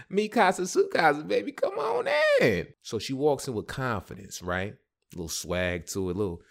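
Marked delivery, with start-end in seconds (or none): 0.1-2.7 high, playful showman's invitation; hammed-up giddy hospitality; eager welcome. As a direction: climb into a squealed, louder welcome. Eagerly welcomes the woman inside.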